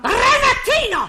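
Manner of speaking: very high shrieked call of his name. Acted. Mother stopping her son's cheek, very high shriek. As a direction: scream his name to stop him.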